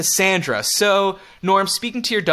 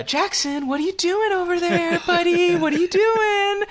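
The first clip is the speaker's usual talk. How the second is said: put-on high sing-song baby talk; baby talk to toddler; 13 semitones higher than usual. Mocking parents who baby-talk a toddler, high cooing. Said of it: "not like ... normal voice".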